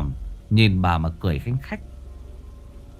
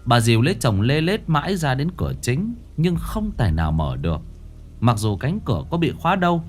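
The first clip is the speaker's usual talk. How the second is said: plain reading voice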